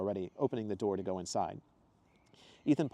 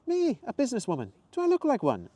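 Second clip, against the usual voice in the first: much higher, louder mock-serious question; mock serious Zoe; 6 dB louder than usual. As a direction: play at being offended. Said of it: "with a sudden seriousness".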